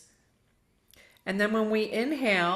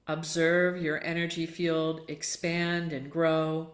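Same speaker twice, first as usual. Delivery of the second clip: guided relaxation; 2 semitones lower than usual. Acted. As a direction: ease them into heaviness, unhurried. Leads Yoga Nidra meditation.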